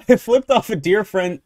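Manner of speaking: usual speaking voice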